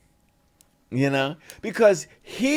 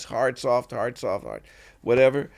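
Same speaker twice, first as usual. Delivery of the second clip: usual speaking voice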